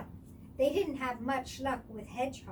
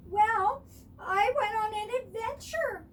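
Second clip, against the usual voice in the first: high, loud little fawn voice; Baby Deer; 9 semitones higher, 8 dB louder than usual. A narrator's reading. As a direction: sound small and proud.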